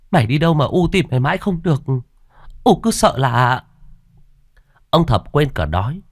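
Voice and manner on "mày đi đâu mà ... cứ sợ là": higher-pitched frantic worry; mother's anxious outburst; panicked mother. A narrator's reading. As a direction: scold the found child in alarm. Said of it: "nói bằng giọng hoảng hốt".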